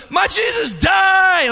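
put-on high, drawn-out childlike shout; loud, slow, high-pitched child's outcry; imitated child screaming. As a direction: mimic a distraught boy screaming. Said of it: "he starts screaming it".

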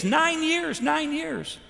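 high-pitched imitation of a tearful cry; retold weeping. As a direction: imitate someone overwhelmed, voice lifted high, without real sobs. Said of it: "She's weeping and sobbing".

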